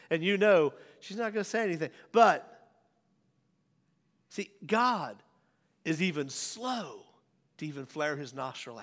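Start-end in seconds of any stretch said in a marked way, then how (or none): none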